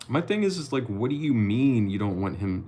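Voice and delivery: ordinary talk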